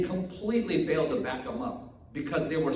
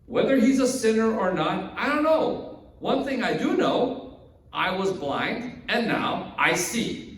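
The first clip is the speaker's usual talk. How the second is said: exasperated; 4 semitones higher, 4 dB louder than usual. Acted. Healed man answering the Pharisees' endless questions, exasperated. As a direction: insist as if worn out by questions. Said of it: "He's, like, so exasperated".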